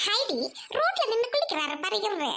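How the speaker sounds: normal talking voice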